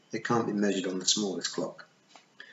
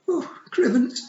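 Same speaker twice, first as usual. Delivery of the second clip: tiny voice; 5 dB louder than usual. A narrator's reading. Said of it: "in a tiny voice".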